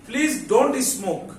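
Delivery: very slow, careful English; slow demo reading. Shown as a demonstration. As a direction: spell it out slowly for the class. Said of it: "সবাই একটু খেয়াল করো". (ধীরে ধীরে স্পষ্ট করে পড়া)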